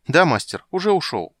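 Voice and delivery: high, loud eager reply; bursting to go. Acted. Крыса рвётся в путь. (радостно и нетерпеливо)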